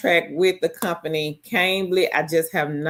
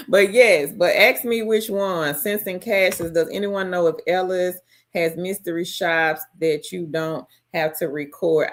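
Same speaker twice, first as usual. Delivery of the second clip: usual speaking voice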